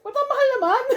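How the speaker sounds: laughing. Retold or imitated. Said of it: "sabi ko".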